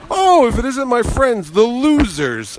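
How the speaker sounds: hammed-up greeting, pitched high; Adam West. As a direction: draw out the teasing welcome grandly. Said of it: "and he goes".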